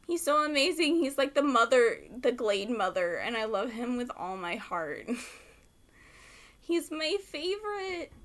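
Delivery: usual speaking voice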